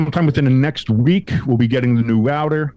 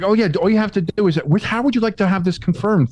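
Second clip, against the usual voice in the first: chipper agent; 9 semitones higher than usual. Retold or imitated. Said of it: "she was like".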